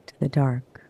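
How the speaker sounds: ordinary delivery, nothing marked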